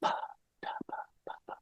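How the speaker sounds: soft unvoiced puffs of breath; whispered percussive syllables. Shown as a demonstration. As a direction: whisper separate popping consonants. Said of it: "give it a percussion".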